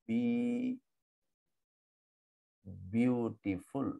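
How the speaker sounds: বিউটিফুল drawn out slowly, broken into syllables. Shown as a demonstration. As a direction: stretch and separate each syllable of বিউটিফুল. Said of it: "তিন অথবা বেশি syllable". (বিউটিফুল টেনে টেনে syllable ভেঙে বলা)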